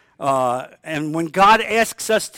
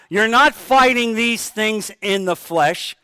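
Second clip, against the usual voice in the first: ordinary talk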